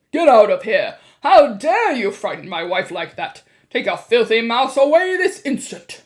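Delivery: loud, furious bellow; shouting. A narrator's reading. Mr. Jenkins towers over them, shouting. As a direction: order them out at full volume.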